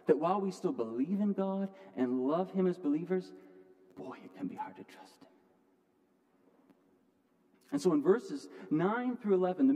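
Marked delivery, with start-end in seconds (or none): 4.0-5.3 hushed, breathy aside; whisper. Acted. Pastor confiding to struggling listeners, in a whisper.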